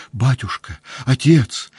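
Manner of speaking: high pleading cry. A narrator's reading. Woman clutches Pierre's legs and cries out. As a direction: cry out to him for help. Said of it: "закричала она".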